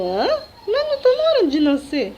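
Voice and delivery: high, startled disbelief; surprised protest. Retold or imitated. Answering the doctor, high startled disbelief. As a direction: protest it can't be time yet.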